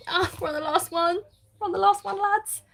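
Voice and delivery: high-pitched, excited announcement to the gang. Real, not acted. Crafter telling viewers it's the last one, excited. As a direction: lift it up high, thrilled to be nearly finished. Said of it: "I'm getting excited".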